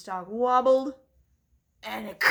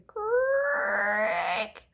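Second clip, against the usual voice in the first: door creak; 12 semitones higher than usual. A narrator's reading. Door opening sound.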